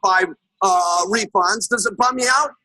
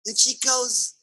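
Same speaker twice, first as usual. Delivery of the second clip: usual speaking voice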